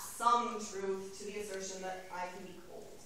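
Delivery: ordinary talk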